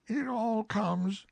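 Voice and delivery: high bear voice, a little slow and grumpy; mildly cross Pooh. Acted. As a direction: grumble it, put out. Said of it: "said Pooh crossly".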